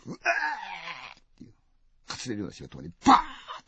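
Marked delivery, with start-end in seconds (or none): 0.1-1.5 slow, drawn-out high cry; dying cry. Acted. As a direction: stretch the cry out.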